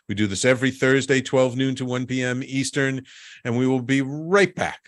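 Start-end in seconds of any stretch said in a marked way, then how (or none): none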